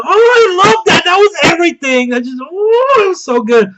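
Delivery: high, loud fan squeal; real high-pitched gushing, easing off at the end; gushing excitement. Real, not acted. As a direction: squeal it out, overwhelmed with delight. Gushing over a favourite scene.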